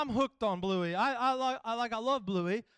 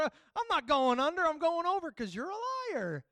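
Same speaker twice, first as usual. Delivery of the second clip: mock defiance; 6 semitones higher than usual. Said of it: "you just stop and go".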